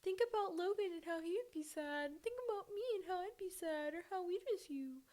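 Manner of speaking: high, whiny mock voice of pleading family; mocking loved ones. Mocking family who beg her to stay, high mock whine. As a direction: parrot their guilt-trip in a high sing-song. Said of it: "When y'all tell me that, like".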